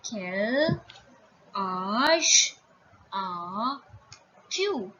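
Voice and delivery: "Quel ... âge ... as ... tu", each drawn out; word by word. Shown as a demonstration. Teacher introducing the informal age question, word by word. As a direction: lengthen each word, pause between. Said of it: "You will say".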